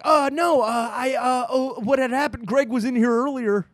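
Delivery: high flustered stammer; flustered excuse. Retold or imitated. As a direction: fumble an excuse, caught out. Girlfriend quoted making excuses.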